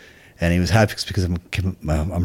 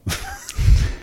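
amused laugh. Real, not acted. Laughing about never being infantry.

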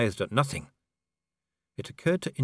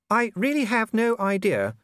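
a noble lady musing aloud; Lady Sybil; 13 semitones higher, 4 dB louder than usual. A narrator's reading.

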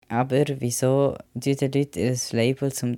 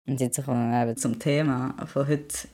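ordinary delivery

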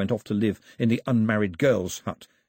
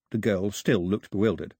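usual speaking voice

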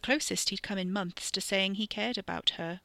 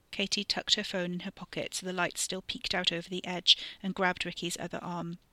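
ordinary delivery, nothing marked

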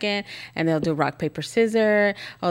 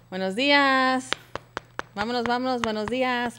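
morning wake-up call; 5 semitones higher than usual. Shown as a demonstration. Mom waking kids at their door, singsong. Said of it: "I knock on the door like this".

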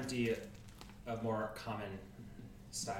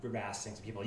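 ordinary talk

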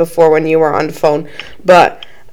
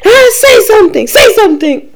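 high-pitched mock scolding; threatening mom; 12 semitones higher, 3 dB louder than usual. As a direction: play a mother threatening with a slipper. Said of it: "threatening me with the fucking slipper, she's like".

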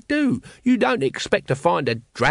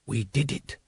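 hushed, soft character whisper; 6 dB quieter than usual. A narrator's reading. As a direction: whisper it under your breath, much softer. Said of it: "he whispered".

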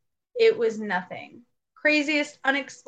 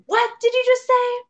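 much higher, louder frightened demand; alarmed challenge; 13 semitones higher, 5 dB louder than usual. Retold or imitated. Confronting a possible intruder with a knife, alarmed.

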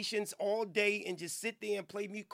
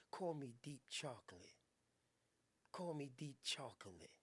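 very low, soft, crooning come-on; deep seductive voice; 8 semitones lower, 12 dB quieter than usual. Pretend. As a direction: purr it low like a smooth lover. The same deep lover voice.

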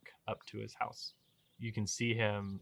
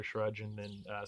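normal talking voice

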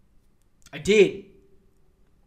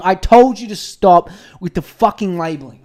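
fed up; 8 dB louder than usual. Real, not acted. Angry rant at listeners.

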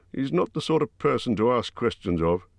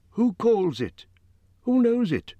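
much higher, urgent little hobbit; Bilbo pressing; 14 semitones higher than usual. A narrator's reading. Hobbit pressing Gandalf about the stranger, high and urgent. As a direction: push the questions up high and fast.

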